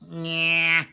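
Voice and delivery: loud, high nasal sound; Bugs Bunny. Shown as a demonstration. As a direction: honk it through the nose. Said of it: "using your".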